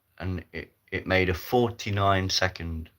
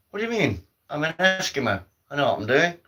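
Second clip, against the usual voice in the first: indignant; 8 semitones higher, faster than usual. Acted. Eskimo protests indignantly.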